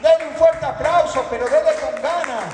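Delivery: high, ringing shouted proclamation; rally the congregation with rising fervor; exultant shouted sermon; full-force preaching. Real, not acted.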